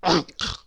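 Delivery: short snorted oink; pig oink. Acted. Pig with bucket on her head, oinking. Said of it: "oinked Polly the pig".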